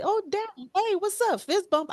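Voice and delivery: put-on high, chummy toddler voice; imitated little son. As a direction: greet dad like a delighted little boy. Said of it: "'Cause he'd be like".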